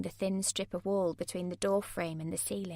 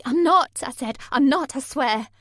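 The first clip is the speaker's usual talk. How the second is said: higher, louder desperate pleading; panicked denial; 5 semitones higher, 9 dB louder than usual. A narrator's reading. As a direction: beg him to believe you, frightened. Heroine denying in panic.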